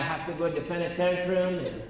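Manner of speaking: ordinary talk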